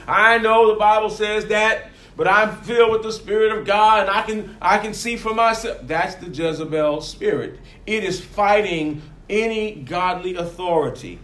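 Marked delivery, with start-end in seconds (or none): 0.1-5.8 higher, louder, self-righteous retort; smug know-it-all. Retold or imitated. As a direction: brush off correction as if spirit-filled. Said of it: "And you say".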